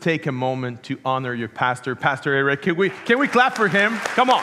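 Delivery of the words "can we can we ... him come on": rallying call. Real, not acted. Pastor asks church to clap.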